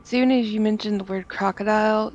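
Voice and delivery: ordinary delivery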